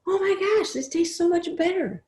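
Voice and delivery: put-on high-pitched childlike monkey delight; delighted young monkey. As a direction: play the thrilled little youngster. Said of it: "and said".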